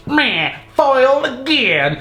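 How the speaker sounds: high, slow cartoon grumble; annoyed villain. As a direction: play a thwarted villain, hammed up. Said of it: "she's all annoyed".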